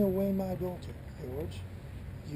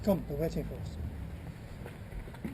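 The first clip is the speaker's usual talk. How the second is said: slow, soft whisper; hushed urging; 4 semitones lower, 7 dB quieter, much slower than usual. Acted. Father leading his daughter to the altar, whispering. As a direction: quietly coax her along.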